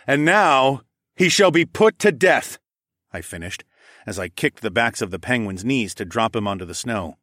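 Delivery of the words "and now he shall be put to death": slow, loud solemn sentence; grave proclamation. A narrator's reading. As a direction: pronounce doom slowly. Hero pronounces sentence.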